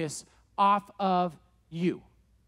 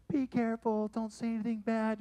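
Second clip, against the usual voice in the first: nervous warning; 2 semitones higher, 5 dB quieter than usual. Retold or imitated. Helpers warn each other nervously.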